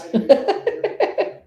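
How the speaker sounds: sudden high laugh; burst of laughter. Real, not acted. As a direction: break into a short giggle. Laughs at hoping not seen.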